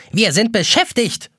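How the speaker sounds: busy egg timer. A narrator's reading. Egg timer keeps scolding.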